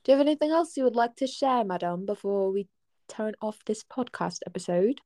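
hammed-up British podcast host; put-on British accent. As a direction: ask it in a mock British accent. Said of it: "I used to do a killer British accent".

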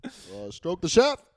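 halting "uh", then a punched-out phrase; Obama doing Andrew Dice Clay. Pretend. Obama starts doing a crude comic's act, slow punchy impression. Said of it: "Obama starts doing fucking, uh, Andrew Dice Clay".

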